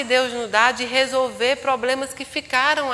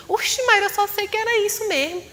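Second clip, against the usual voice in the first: friend's excuse; 9 semitones higher than usual. Retold or imitated. Friend's sheepish reply voiced.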